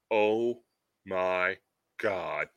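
very low, hushed, word by word; hammed-up solemn awe; mock awed revelation. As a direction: drop it deep and soft, pausing after each word. Wrestler's revelation in acted story.

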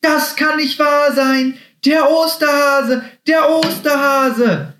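very high, drawn-out squealed shouts; joyful screaming. A narrator's reading. Happy sees the Easter Bunny, screaming with joy. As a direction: scream it in overjoyed disbelief. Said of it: "schrie er und hüpfte freudig im Kreis herum".

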